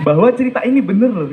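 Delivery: ordinary talk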